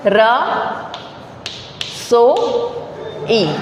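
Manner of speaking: "रसोई" very slowly, pausing between र, सो and ई; syllable by syllable. Shown as a demonstration. Teacher sounding out an example word for students, "रसोई" syllable by syllable. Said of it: "तो इसका कोई word देखिए".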